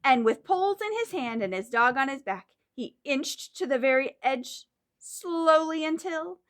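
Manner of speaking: lively storybook reading; reading to children. Acted. Teacher reads the story to the class. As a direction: read it like a story for little ones. Reads picture book aloud.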